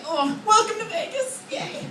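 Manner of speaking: high, small fake cheer; feebly celebrate, trailing into a tiny yay; sarcastic welcome. Sarcastic weak welcome after hostile neighbors.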